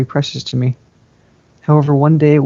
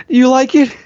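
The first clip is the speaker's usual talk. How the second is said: giggling, breaking up mid-question; can barely get the words out for laughing; laughing through a line; 11 semitones higher than usual. Acted. Reading a silly story aloud to friends, giggling. Dramatic reading of a fan fiction.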